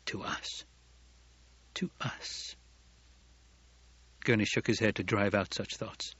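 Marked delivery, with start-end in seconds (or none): 0.1-2.6 hushed, voiceless whisper; whispered thought. A narrator's reading. Gurney silently echoes Paul's words, whispering.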